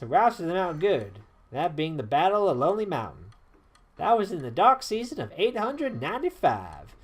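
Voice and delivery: put-on lively, swooping southern-accented yarn; old-timer storyteller. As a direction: recount old battles with relish. Voices Traherne recounting history.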